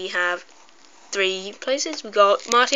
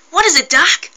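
put-on high, louder teen voice; Marty voice; 6 semitones higher, 6 dB louder than usual. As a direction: answer Doc in a high voice. Back to the Future figures talking.

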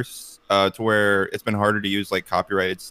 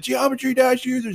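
high-pitched mock announcement; raised, cartoonish call-out; banner announcement; 13 semitones higher than usual. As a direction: read out a banner in a put-on high voice. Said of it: "this banner that said".

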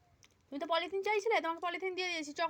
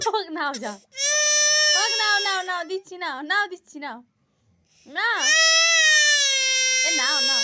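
loud coaxing; 10 semitones higher, 11 dB louder than usual. Real, not acted. Coaxing a crying child.

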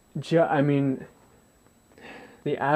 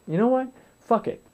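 higher, fed-up burst; sudden resolve; 8 semitones higher than usual. Retold or imitated. Exhausted at night, deciding to lie down, fed up. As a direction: snap into a decision.

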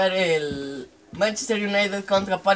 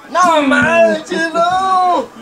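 surprised; 8 semitones higher, 6 dB louder, much slower than usual. Real, not acted. Reacting to saved penalty.

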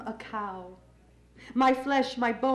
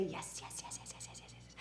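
rapid voiceless whisper, fading away; whispered refrain; 7 semitones lower, 20 dB quieter, faster than usual. Acted. Poet answering when called a cat, whispered. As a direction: whisper the yeses in a string, ever softer.

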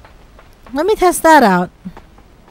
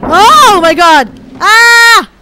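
loud high panicked shriek; panicked scream; 11 semitones higher, 8 dB louder, much slower than usual. Real, not acted. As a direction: scream in sudden fright. Falling in game.

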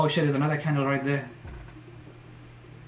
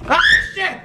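high loud startled curse; frightened yelp; 7 dB louder than usual. Real, not acted. Gamer spooked again: high startled yelp. Scared by clown.

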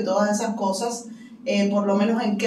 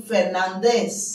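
"Fernández" stressed on the last syllable, dez; French final-syllable stress; slower than usual. Shown as a demonstration. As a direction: pronounce "Fernández" as fer-nan-DEZ. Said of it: "dan la fuerza de pronunciación en la última".

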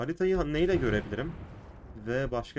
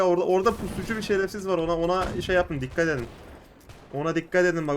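ordinary talk

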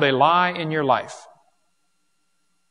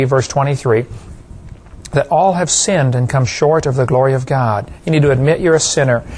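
louder, steady preacher's closing invitation; formal recorded appeal; 3 semitones lower, 7 dB louder than usual. Acted. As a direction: read it like a ministry video ending, earnest and measured. Recorded closing altar call.

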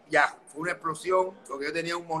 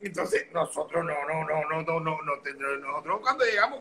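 normal talking voice

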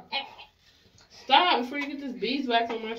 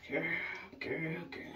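whisper; 6 semitones lower, 12 dB quieter than usual. Real, not acted. Exasperated mom aside.

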